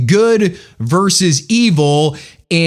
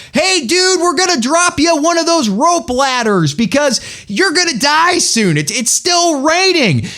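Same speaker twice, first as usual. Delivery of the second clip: higher, lightly hammed-up rescuer on a loudspeaker; mock loudspeaker call; 7 semitones higher than usual. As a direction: call down from the helicopter, a bit raised. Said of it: "they say through a loudspeaker".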